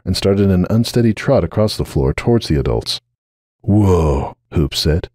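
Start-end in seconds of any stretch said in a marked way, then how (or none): 3.6-4.3 winded. Acted. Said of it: "sounding suddenly winded".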